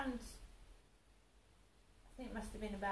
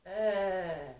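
mother's loud uh; 12 dB louder than usual. Retold or imitated. Mother pauses on voicemail.

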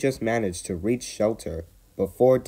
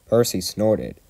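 ordinary delivery, nothing marked

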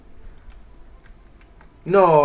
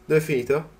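ordinary talk

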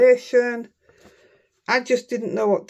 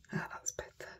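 whispered relief; 20 dB quieter than usual. Real, not acted. Relief muttered to herself.

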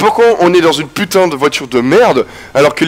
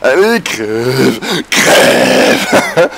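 screamed crève; much slower than usual. Real, not acted. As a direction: scream the finishing word. Streamer screaming as enemy dies.

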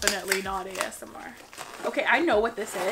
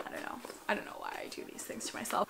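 whisper; 12 dB quieter, faster than usual. Real, not acted. Muttered self-aside.

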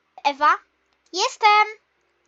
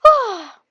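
exhausted puff; 6 semitones higher, 10 dB louder than usual. Acted. Exhausted after the plank.

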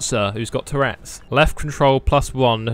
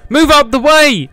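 frantic shout; 12 semitones higher, 7 dB louder, slower than usual. Real, not acted. Fleeing through crowd in game.

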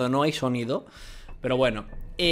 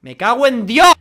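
much higher, much louder curse; loud outburst; 8 semitones higher, 12 dB louder than usual. Acted. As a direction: blast the swear out. Reading a shocking message aloud. (lee el mensaje a gritos)

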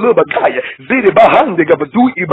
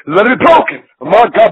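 ordinary talk

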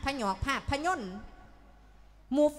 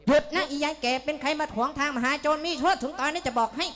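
higher and louder, a hard threatening robber; louder, higher mock bandit threat; 4 semitones higher, 5 dB louder than usual. Shown as a demonstration. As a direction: bark it like a movie bandit blocking the road. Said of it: "สาธิตให้เบิ่งเนาะ".

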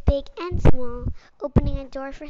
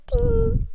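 ding; 9 semitones higher, 5 dB louder than usual. Acted. Sound effect after smile.

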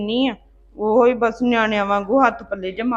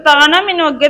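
angry snap; 5 semitones higher, 7 dB louder than usual. Acted. Scolding sister-in-law.

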